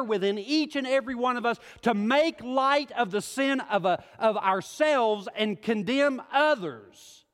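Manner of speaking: high, loud preacher's build, driving hard; rising sermon fervor, loud and high; full-force preaching. Acted. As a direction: preach it to the whole room, pressing.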